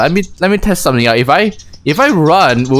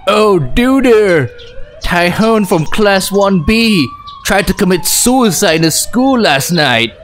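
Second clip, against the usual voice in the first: higher-pitched laid-back dude; put-on stoner-like dude, pitched up; 5 semitones higher, slower than usual. Player voices classmate Seho telling shocking news, laid-back voice. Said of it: "laid back voice".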